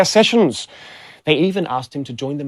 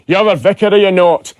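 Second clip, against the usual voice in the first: loud, higher-pitched thick Scottish voice; thick Scottish accent; 4 semitones higher, 6 dB louder than usual. Acted. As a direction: ask it as a broad Scotsman. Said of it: "in a thick Scottish accent".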